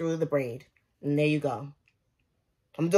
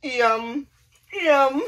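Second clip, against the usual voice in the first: mock TikTok yummy; 4 semitones higher, slower than usual. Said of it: "Do y'all watch that TikTok? And they be like".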